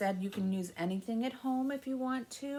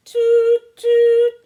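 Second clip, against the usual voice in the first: high, loud train whistle toots; piping train whistle sounds; 11 semitones higher, 17 dB louder than usual. Pretend. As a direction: toot like a little train whistle. Train whistle in children's rhyme.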